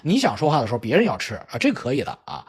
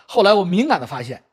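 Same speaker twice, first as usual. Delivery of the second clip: ordinary talk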